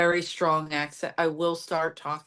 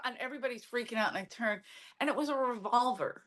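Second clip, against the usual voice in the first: normal talking voice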